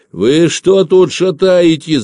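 high, loud, drawn-out yell; shouted challenge. A narrator's reading. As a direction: call out sharply from a distance. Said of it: "закричал появившийся откуда-то Собакин".

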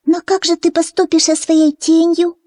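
very high small chick voice; peeping baby chick. A narrator's reading. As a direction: ask as a tiny chick. Chick Fyu asks duckling.

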